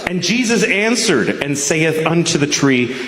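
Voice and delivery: solemn Bible-reading voice, measured; scripture reading. Acted. Comic reading scripture to the crowd, solemn Bible voice. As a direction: read it like a preacher at the pulpit. Reads scripture in King James style.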